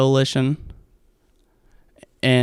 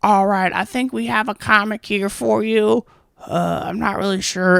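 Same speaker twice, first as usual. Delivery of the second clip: higher, unsure host voice; clueless host; 8 semitones higher than usual. Retold or imitated. Host introducing a missing comic, unsure high voice. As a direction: play the host who forgot the comic was there. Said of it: "he goes up there and he's like".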